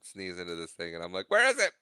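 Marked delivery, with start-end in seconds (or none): 1.3-1.7 high-pitched, hurried mock panic; mock frantic. Host hunting for the mute button, mock frantic. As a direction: imitate a frantic search, pitch shooting up.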